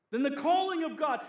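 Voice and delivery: ordinary delivery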